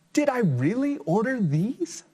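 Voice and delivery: high, startled farmer exclaiming; astonished farmer. Acted. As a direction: squeal it like a stunned farmer. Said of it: "said the farmer".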